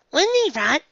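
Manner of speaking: put-on loud, high gushing girl; high excited gush. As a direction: gush it eagerly. Nora character voice.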